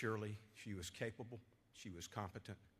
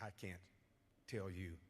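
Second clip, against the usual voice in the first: ordinary talk, nothing marked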